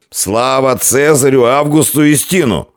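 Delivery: high, shouted salute; loud acclamation. A narrator's reading. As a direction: cry it out like a loyal cheer. Placating echo or renewed drunken cheer.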